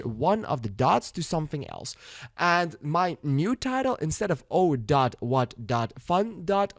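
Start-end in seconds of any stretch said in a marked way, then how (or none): none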